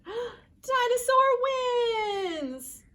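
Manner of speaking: loud, high, stretched victory cry; gasp, then cheer the winner; big slow cheer; triumphant announcement. A narrator's reading.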